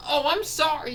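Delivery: high, loud hammed-up apology; frantic apology. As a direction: plead sorry with the voice shooting up. Character apologises to angry drivers.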